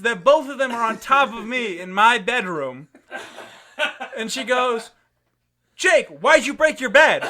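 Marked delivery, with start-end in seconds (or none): 5.8-7.3 high, loud scolding mother; retold outraged shout, pitched way up; mock angry yell. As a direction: imitate mom yelling at her kid.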